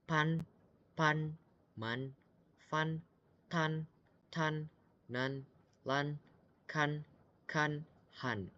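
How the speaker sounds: teacher reading pinyin syllables slowly for the class; each syllable said clearly and on its own; pinyin drill. Shown as a demonstration. Teacher drilling students: syllables read one by one. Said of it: "kita coba pelan-pelan ya".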